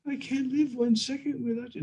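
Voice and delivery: a hint of a tender maiden's voice; Radharani pleading. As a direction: beg him not to leave.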